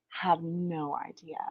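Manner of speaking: hushed, breathy aside to the viewers; whisper. Real, not acted. Whispering to her viewers that they have no idea. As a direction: drop to a secret whisper for effect.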